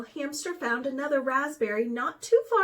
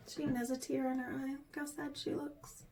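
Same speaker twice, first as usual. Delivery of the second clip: fast, much softer aside; quiet aside; 10 dB quieter, faster than usual. Real, not acted. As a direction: lean in and point it out. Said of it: "aside to child listener".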